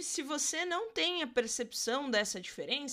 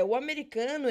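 usual speaking voice

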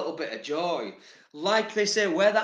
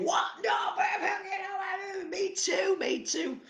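much higher, squeaky and excited; squeaky mock reply. Vlogger answering himself in a squeaky high voice. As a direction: pipe up like an eager kid.

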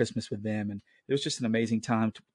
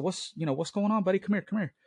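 concerned calling; 9 semitones higher than usual. Retold or imitated. Father calls to crying son.

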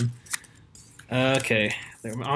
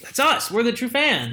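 excited; 9 semitones higher than usual. Real, not acted. Playful boast.

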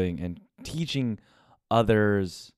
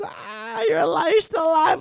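high mock-surprised shout; father teasing, high shouted mock surprise. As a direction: yelp it out like a sneering dad.

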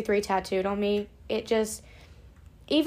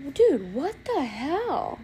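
startled reaction; 4 semitones higher, much slower than usual. Real, not acted. Startled by noise outside mid-recording.

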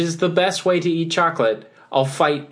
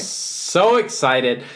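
drawn-out excitement; much slower than usual. Pretend. Answers how excited he is.

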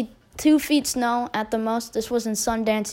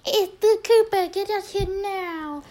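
urgent call; 10 semitones higher than usual. Retold or imitated. Redoing the brother's call to Cooper, very high-pitched mock holler. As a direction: exaggerate a brother's urgent squeal of a summons. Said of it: "Yeah, it's definitely".

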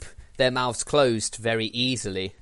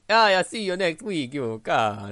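mock Dracula-Italian sign-off, a bit higher; a hammy vampire-Italian farewell; Dracula Italian accent; 5 semitones higher, 4 dB louder than usual. Friend imitates last week's outro in a Dracula Italian accent. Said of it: "kind of Dracula Italian".

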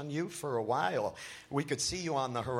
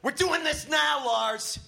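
higher, louder forceful growl; 10 semitones higher, 4 dB louder than usual. A narrator's reading. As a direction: bark it at Lars. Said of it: "Matt growls".

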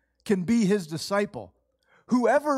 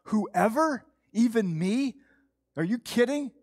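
higher, rising astonished questions; incredulous questioning; 4 semitones higher than usual. Retold or imitated. Preacher recalling first understanding the gospel, incredulous. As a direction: relive the disbelief, pitch lifting on each question. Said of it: "I thought".

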